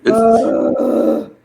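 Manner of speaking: loud exasperated ugh; disgusted groan. Real, not acted. Annoyed about Panthers' quarterback.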